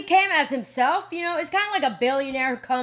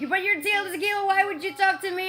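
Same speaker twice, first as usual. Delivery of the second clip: higher, awestruck quoting of the janitor; imitating the janitor; 7 semitones higher, faster than usual. Tila retelling the janitor's amazement, higher awestruck voice. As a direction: play him starstruck and disbelieving. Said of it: "and he's like".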